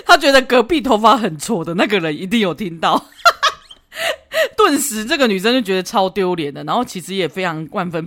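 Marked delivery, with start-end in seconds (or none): 3.1-4.5 high, loud cackling laugh; burst of laughter. Real, not acted. Host bursts out laughing at the boyfriend's blunder. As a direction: crack up helplessly.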